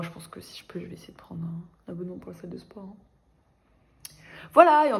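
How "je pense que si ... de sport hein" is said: soft unvoiced whisper aside. Real, not acted. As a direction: drop to a whisper, half confessing. Whispered aside about the gym.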